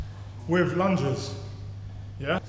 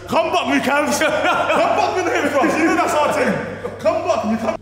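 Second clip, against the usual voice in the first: loud, high calls across the gym; calling out; 8 semitones higher, 8 dB louder, slower than usual. Real, not acted. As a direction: yell across the gym to a mate. Calling out to friend Cabs.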